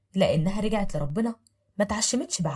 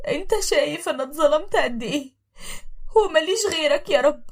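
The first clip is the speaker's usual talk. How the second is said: tearful prayer; 8 semitones higher, 4 dB louder than usual. Acted. Thrown-out wife praying to God, crying. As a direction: pray through tears. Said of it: "وقالت بصوت واطي".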